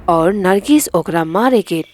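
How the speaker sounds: ordinary delivery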